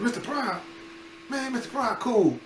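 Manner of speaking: amazed friend. Retold or imitated. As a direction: squeal the name like it's the best news.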